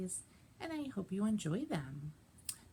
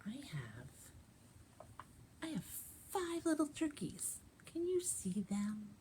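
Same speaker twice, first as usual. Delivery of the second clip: hushed finger play; 4 semitones higher, 4 dB quieter than usual. Acted. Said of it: "finger play I'd like to share".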